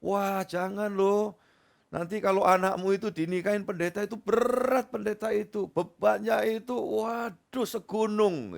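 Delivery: mock the pastor discouraging the parents; imitating a pastor. Pastor warns parents against a pastor son-in-law, mock dramatic. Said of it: "Lantas pendeta itu sendiri ngomong".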